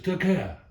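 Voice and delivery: sudden high, loud mimicked stab sound; stab noise. Showing how she starts with a heart stab, imitated strike noise. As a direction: punch out a sharp stabbing sound effect.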